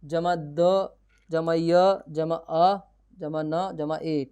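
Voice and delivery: very slow, each letter and जमा separated; slow spelling out. Shown as a demonstration. Teacher joining the letters for students, very slow. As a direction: build the word letter by letter, slowly. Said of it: "अब ये लिखा है".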